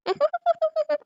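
high, soft bashful giggle; shy giggle. Acted. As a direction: giggle shyly to herself. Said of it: "எனக்கு வெக்க வெக்கமா வருதே". (வெட்கத்துடன் சிரிப்பு)

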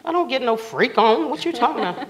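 high, loud mock indignation; loud, incredulous retort; indignant comeback. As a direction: act out being scandalized, half laughing. Indignant mock reply in story.

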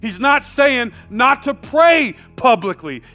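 emphatic pulpit delivery; full-force preaching. Real, not acted. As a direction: drive each point home to the congregation.

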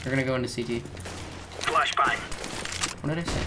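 ordinary talk

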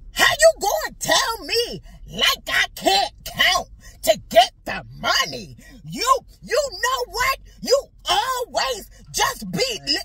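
loud, high-pitched mock tirade; yelling. Partner raging at wife over the money, yelling. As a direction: rant at full tilt as the angry partner. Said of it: "forgive me for yelling".

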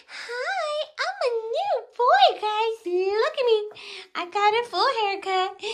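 voicing the dog. Owner speaking for her groomed Yorkie to viewers, high puppy voice. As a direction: speak as the little dog showing off his haircut. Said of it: "Say hi, Iggy".

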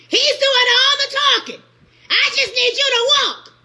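high, loud preaching; preaching shout. Real, not acted. Preacher shouting at the congregation about walking. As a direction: drive it home at full force.

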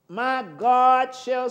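very slow "My God shall", stretched word by word; drawn-out scripture reading; slowly. Shown as a demonstration. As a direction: take it slow and weighty. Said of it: "don't say it too fast".

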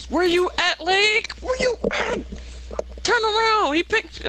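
high calling out for a teammate. Real, not acted. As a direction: call out high to find your partner.

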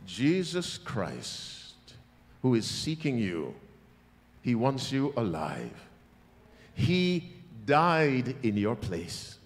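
said slowly. Real, not acted. Preacher slowing down so the congregation takes it in, slow. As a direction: lay each phrase down slowly and gently. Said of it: "Let me say it slowly".

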